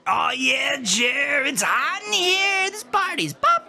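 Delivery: a dopey, glassy-eyed stoner greeting the quarterback; stoned party guy. Acted. Stoner yelling at loud party.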